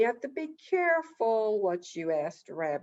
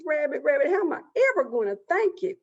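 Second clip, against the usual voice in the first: high, loud, rushed gratitude; overjoyed animal, fast and high; ecstatic Possum; 6 semitones higher, 5 dB louder, much faster than usual. Acted. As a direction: gush thanks, bursting with excitement. He was so ecstatic.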